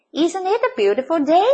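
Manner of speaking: high-pitched, chirpy question; cheerful call. A narrator's reading. Tumbleweed chatting to an unwilling cactus, calling out cheerfully. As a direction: call it out, lifting high at the end. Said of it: "Rosie the tumbleweed called out".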